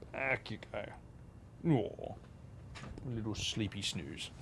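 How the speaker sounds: soft, slow, cooing; tender to pet. Real, not acted. Owner settling his cat on his lap, soft slow cooing.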